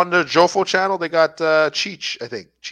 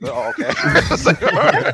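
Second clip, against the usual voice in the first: mock reply through laughter; 3 semitones higher than usual. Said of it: "he's like".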